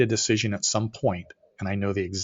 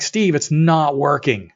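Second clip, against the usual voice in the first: slow, loud, higher-pitched insistence; emphatic retort; 6 semitones higher, 7 dB louder, much slower than usual. Retold or imitated. Arguing with his partner over the company, exasperated emphasis. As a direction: spell out "not working" slowly and forcefully. Acted quarrel with business partner.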